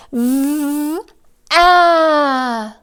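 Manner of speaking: V then ah, sounded out; phonics sounds. Shown as a demonstration. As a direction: sound out V and ah separately. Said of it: "let's start with the first sound".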